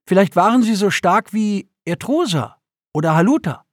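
put-on high, bright childish guess; eager boy. A narrator's reading. Boy offers his father a fanciful guess, eager. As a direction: toss out a wild idea like a kid.

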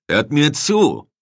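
high, loud, drawn-out shout for attention; slow, commanding shout to the troops; loud call. A narrator's reading. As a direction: call out so the whole alley hears. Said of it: "rief Casal so laut".

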